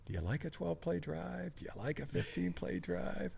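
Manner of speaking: a coach quietly putting the D line in its place; coach's pointed question. Retold or imitated. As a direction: needle him with a leading question, quietly. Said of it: "Good point, Coach".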